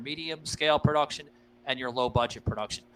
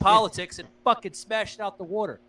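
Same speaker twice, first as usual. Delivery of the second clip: mock trash talk; 7 semitones higher than usual. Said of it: "not being an asshole and saying".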